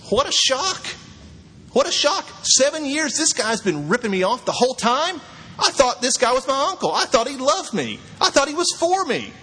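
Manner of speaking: high, stunned voice of a man who has been cheated; shocked betrayal. Acted. Jacob realizing his uncle cheated him, shocked and hurt. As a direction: let it climb in disbelief as each realization lands.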